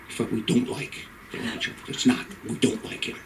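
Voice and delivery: hushed confiding aside; secretive whisper. Acted. Comic confides to the crowd about the leprechaun, whispered. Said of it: "share a little inside information".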